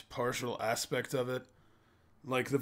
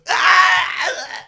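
high shrieking yelp as he's blasted; hero scream; 15 dB louder than usual. Retold or imitated. Little hero hit by the spell, high shrieking scream. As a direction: squeal as you get thrown away. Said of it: "And the little hero goes".

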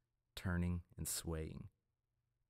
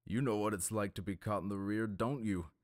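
fast, a touch louder leering taunt; leering jibe; 3 semitones higher, 4 dB louder than usual. A narrator's reading. Red Oarsman jeering at the priest, fast leer. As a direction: needle him quickly.

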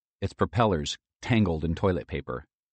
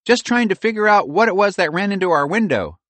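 squirrel voice; 12 semitones higher, 6 dB louder than usual. A narrator's reading. Pearl continues as squirrel.

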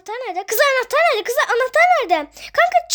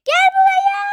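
urgent calling; 8 semitones higher, 4 dB louder than usual. Pretend. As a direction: yell for them to come over. Urgent summons after countdown. (bağırarak çağırma)

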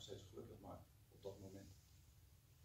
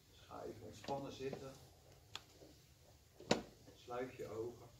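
guided meditation. Acted. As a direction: gently guide them to relax. Leads a short meditation.

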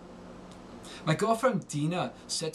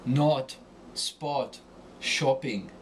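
German-style rounded European short O; German accent; much slower than usual. Shown as a demonstration. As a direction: say "not, spot, shopping" the German way, vowels rounded. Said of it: "Germans pronounce it more European style".

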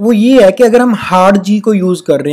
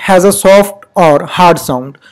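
slow rule reading; much slower than usual. Shown as a demonstration. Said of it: "reading the rule slowly".

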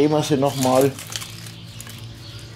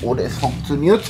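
ordinary delivery

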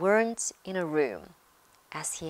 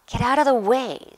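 loud, higher irritated snap; snapping; 6 semitones higher, 10 dB louder than usual. A narrator's reading. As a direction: snap at someone blocking you. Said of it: "snapped Ron".

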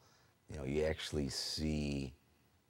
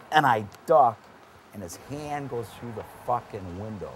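usual speaking voice

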